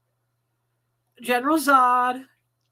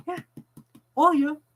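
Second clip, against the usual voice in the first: ordinary talk, nothing marked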